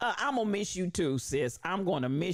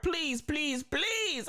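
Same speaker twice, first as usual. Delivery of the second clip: pleading please, pitched much higher each time; 8 semitones higher, much slower than usual. Retold or imitated. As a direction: beg like a soul singer on his knees. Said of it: "I feel the begging spirit of [name]".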